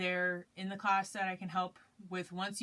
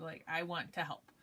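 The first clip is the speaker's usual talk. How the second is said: normal talking voice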